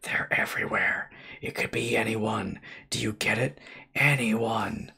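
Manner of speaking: strained voiceless whisper; dying man warning the narrator, whispered; choked whisper. A narrator's reading. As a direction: force the words out through something in the throat. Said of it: "trying to talk through his parasite".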